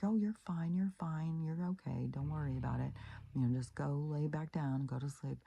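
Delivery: high, hushed reassurance; soothing whisper. Retold or imitated. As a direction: gently hush a frightened patient. Soothing a scared dying woman.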